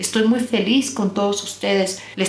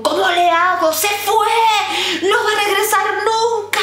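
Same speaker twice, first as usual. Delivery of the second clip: the anxious mind crying that he will never come back, higher and panicky; 11 semitones higher than usual. Retold or imitated. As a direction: act out a frantic, desperate heartbroken person. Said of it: "tengo ansiedad, estoy sufriendo".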